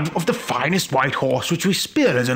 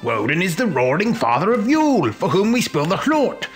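higher, emphatic character voice; incredulous; 5 semitones higher, 3 dB louder than usual. Acted. Neighbour explaining Woden to the ignorant guest, incredulous. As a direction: declare it as if anyone should know. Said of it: "my neighbour said incredulously".

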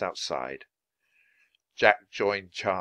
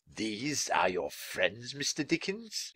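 lightly voiced fussy innkeeper; a hint of a sniffy innkeeper; 3 semitones higher, 8 dB quieter, slower than usual. A narrator's reading.

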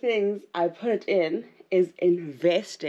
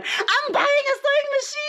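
high, loud excited announcement; excited reveal; 3 dB louder than usual. Real, not acted. Vlogger reveals her big news, squealing with excitement.

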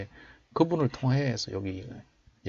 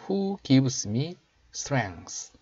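slow, raised teaching voice; slow model reading; 4 semitones higher, much slower than usual. Shown as a demonstration. Teacher showing learners the English verse, slow model reading. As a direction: read it out slowly for learners to copy. Models last part for practice.

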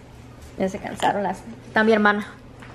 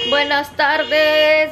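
slow, stretched-out buenas tardes; "Buenas tardes" stretched long and melodic; sing-song greeting; 3 semitones higher, 3 dB louder, much slower than usual. Real, not acted. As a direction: draw out the greeting playfully. Drawn-out greeting to camera.

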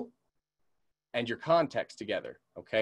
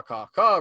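crow caw; 5 semitones higher, 6 dB louder than usual. Shown as a demonstration. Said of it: "Everyone knows caw".

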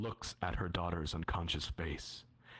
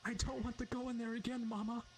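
put-on child shrieking; faster than usual. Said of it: "The child shrieks".

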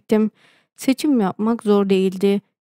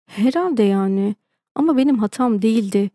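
usual speaking voice

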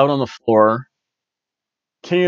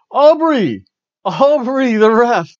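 much higher, loud chanting call; cheering crowd; 10 semitones higher, 4 dB louder, slower than usual. Retold or imitated. Host imitates the crowd cheering the referee, loud. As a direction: cheer her name like the crowd.

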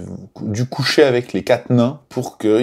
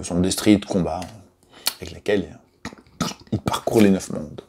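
normal talking voice

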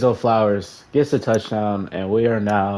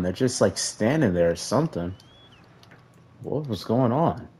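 ordinary delivery

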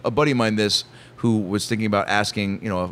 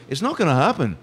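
lightly mimicked star voice, a bit high; light celebrity impression; 5 semitones higher than usual. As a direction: suggest it in a put-on celebrity voice. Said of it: "Paul would turn to look at me".

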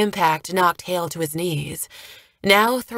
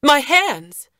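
high, loud screamed plea; girl's desperate shout in a battle; 8 semitones higher, 6 dB louder than usual. A narrator's reading. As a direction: scream it out, pitched well up. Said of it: "The girl screamed".

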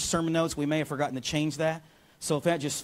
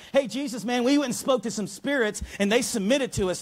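mock excited, higher and bubbly; excited disciples; 6 semitones higher than usual. Disciples bragging to Jesus, mock excited. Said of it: "They're like".

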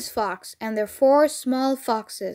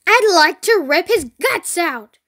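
loud, higher-pitched villain's threat; acted angry threat; 5 semitones higher, 5 dB louder than usual. A narrator's reading. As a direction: spit it out loudly as a vengeful farmer. Furious farmer in a story.

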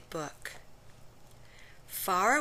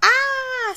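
loud, very high frightened scream; fisherman's scream; 13 dB louder than usual. Acted. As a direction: shriek in sudden terror. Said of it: "screamed the fisherman".

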